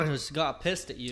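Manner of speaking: ordinary talk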